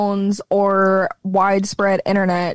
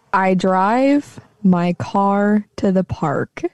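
slow, measured model sentence; demo line; 3 semitones lower, much slower than usual. Shown as a demonstration. Host gives friend a line to copy, slow and clear. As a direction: say it slowly with clear R's in car and park. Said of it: "Just, just say this, say this line".

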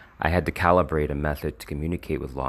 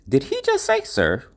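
incredulous high-pitched inner voice; shocked disbelief; 12 semitones higher, 7 dB louder than usual. Retold or imitated. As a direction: jump way up high in stunned disbelief. Inner disbelief at being called sir.

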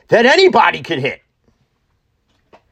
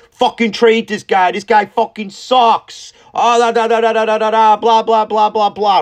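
mock fan rant, higher pitched and sing-song; mocking angry fans; 6 semitones higher than usual. Fan mocking bullpen pitcher after one bad game, higher mock rant. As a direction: ham up the fickle fans turning on him.

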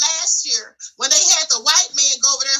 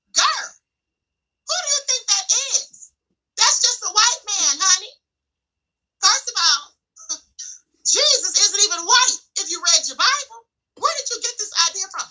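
higher, laughing, sassy put-down; sassy scolding; 5 semitones higher than usual. Retold or imitated. Telling a Kenyan follower the Jesus is fake, sassy and laughing. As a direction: tease her like a know-it-all big sister, chuckling.

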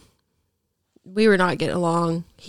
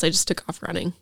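normal talking voice

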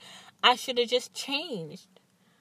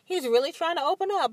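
quick, higher, a touch of excitement; excited hope; 9 semitones higher, faster than usual. Retold or imitated. Hoping her boyfriend opens up, quick excited thought. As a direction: gush hopefully to yourself. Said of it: "I was so excited".

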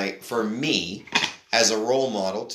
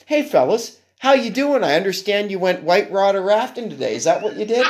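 much higher and louder, overly chummy; overfriendly dad; 7 semitones higher, 3 dB louder than usual. Retold or imitated. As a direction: play the awkward dad trying too hard with teens. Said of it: "I'm like".

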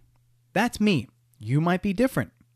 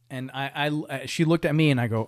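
normal talking voice

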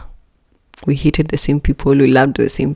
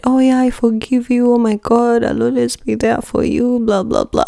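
fake cheerful forgiveness; 7 semitones higher than usual. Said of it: "I was lying".

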